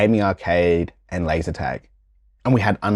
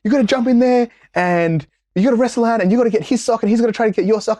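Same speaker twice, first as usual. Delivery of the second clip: camp leader voice; 13 semitones higher than usual. Retold or imitated. Camp leader announcing messy games, in a high cheery voice. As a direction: pump up the kids like a camp leader. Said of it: "it's like".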